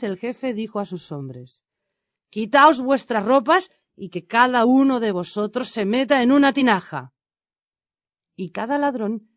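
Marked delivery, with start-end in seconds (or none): 2.3-7.1 raised, higher voice, giving orders; commanding. A narrator's reading. Bandit chief ordering his men into the jars, commanding. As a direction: address the whole band loudly and firmly.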